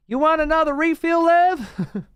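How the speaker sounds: high waitress voice asking Lev about a refill; diner waitress voice. Retold or imitated.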